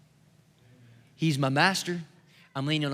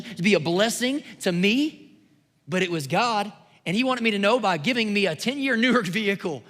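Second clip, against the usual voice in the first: raised, driving sermon voice; rising sermon fervor; preaching; 6 semitones higher, 4 dB louder than usual. Acted. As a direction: preach it to the room. Preaching to the congregation.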